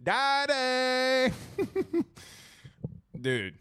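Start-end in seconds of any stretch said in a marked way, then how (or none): 0.0-1.3 put-on child calling. Imitating son calling him.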